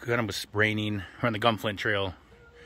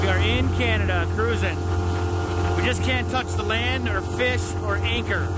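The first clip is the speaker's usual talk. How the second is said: high-pitched, raised voice; calling over engine; 10 semitones higher than usual. Real, not acted. Boater explaining the Canada border rules, calling over engine. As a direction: pitch it up to carry over the motor. Talking over running boat motor.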